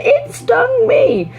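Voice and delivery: very high slow it stung me; kids' mock cry. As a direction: echo it like a toddler. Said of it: "they repeat the".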